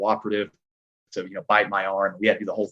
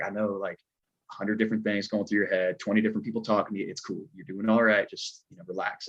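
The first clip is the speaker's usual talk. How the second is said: quietly reassuring, trailing softer; calm reassurance; 6 dB quieter than usual. Retold or imitated. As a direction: settle someone's nerves, voice dropping softly. Retold coordinator calming a nervous stuntman.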